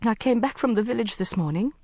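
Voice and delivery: mildly shaky confession; trembling voice. A narrator's reading. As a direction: hold back tears. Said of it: "her voice trembling".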